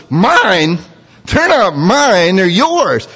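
high, indignant protest; voicing Moses. Moses protesting to God, high and indignant. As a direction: push the people back onto God.

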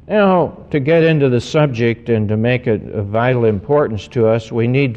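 ordinary delivery

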